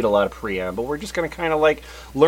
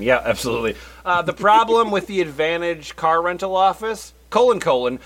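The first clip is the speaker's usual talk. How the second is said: ordinary delivery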